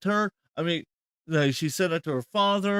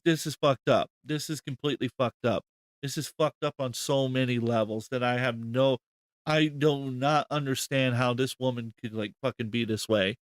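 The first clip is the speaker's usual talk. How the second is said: ordinary talk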